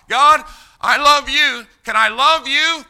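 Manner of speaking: high, loud cry to God; loud plea. Acted. Believer pleads with God for love, loud plea.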